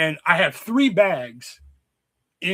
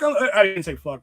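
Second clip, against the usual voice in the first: ordinary talk, nothing marked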